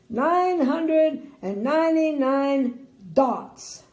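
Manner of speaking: "nine hundred and ninety-nine dots" slow, loud and drawn out; dramatic reveal. A narrator's reading. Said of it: "he paused".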